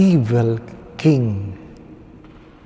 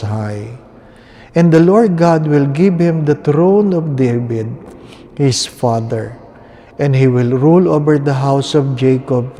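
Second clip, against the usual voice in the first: Gospel proclamation; 6 dB louder than usual. Acted. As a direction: proclaim it like a priest at Mass. Gospel reading continues.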